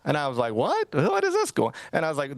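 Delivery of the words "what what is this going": high-pitched, incredulous mock bafflement; retold disbelief. Replying to friends' group text accusation, high-pitched baffled disbelief. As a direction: replay being completely thrown by the accusation, voice leaping up.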